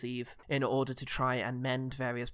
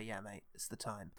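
very soft, low reassurance to a mate; supportive; 6 semitones lower, 15 dB quieter than usual. Retold or imitated. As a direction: gently give him the go-ahead. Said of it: "puts a supportive hand on his shoulder".

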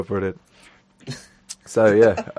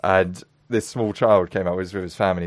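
normal talking voice

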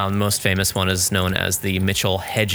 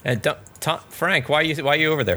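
calling from far away; 6 semitones higher than usual. Real, not acted. Calling teammate to exit.

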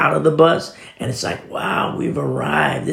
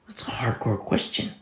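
hushed whispered aside; 8 dB quieter than usual. Acted. Preacher stressing Paul's question to the church, whispered. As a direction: breathe it to the congregation.

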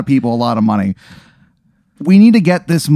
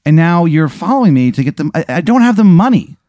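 high, louder indignant complaint; exasperated protest; 6 semitones higher, 4 dB louder than usual. Acted. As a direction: complain to your pursuer, voice climbing. Acted confrontation with the driver.

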